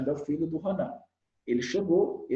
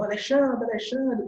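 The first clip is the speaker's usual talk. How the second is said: higher-pitched mock calling of a child; 5 semitones higher than usual. Shown as a demonstration. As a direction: call the name over and over to no effect. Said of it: "às vezes, tá chamando". (chamando a criança em tom mais agudo)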